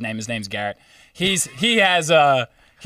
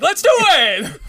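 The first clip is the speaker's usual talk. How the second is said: put-on high, excited drunk cheer; drunk excitement; 5 dB louder, slower than usual. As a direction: pump up the whole group, much higher. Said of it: "they're drunk and they're like".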